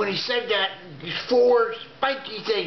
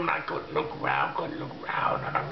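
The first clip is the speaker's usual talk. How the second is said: hushed whisper, paralysed with fear; a frightened whisper in the dark; 4 semitones lower, 6 dB quieter, faster than usual. Retold or imitated. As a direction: breathe the words out in a whisper.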